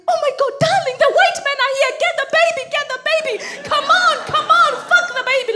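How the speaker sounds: high, loud frantic yelling; hammed-up panic, urging a partner to run; mock-terrified shout; panicked.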